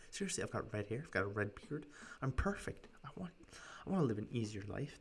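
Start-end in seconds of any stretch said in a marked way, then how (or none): none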